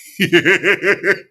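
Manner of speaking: loud, helpless laughing fit; loud laughter. Real, not acted. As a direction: crack up at full volume. Cracks up at replayed clip.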